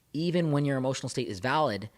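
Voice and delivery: ordinary talk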